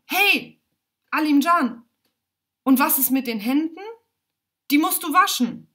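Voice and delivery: high, loud scolding yell; little sister shouting at her brother to wash his hands; full-chested shout. A narrator's reading. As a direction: shout across the room at him. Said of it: "schreit aus voller Brust".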